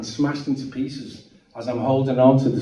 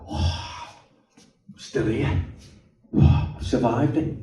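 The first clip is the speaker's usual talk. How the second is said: breathless relief; 2 semitones lower, 7 dB quieter, slower than usual. Retold or imitated. Relief after van stops tumbling.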